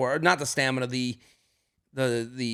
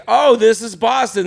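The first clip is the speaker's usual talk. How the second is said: higher, louder, mock delighted discovery; lightly hammed-up surprise; 6 semitones higher, 7 dB louder than usual. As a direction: play it up as a touch of tourist glee. Dublin feels like Boston.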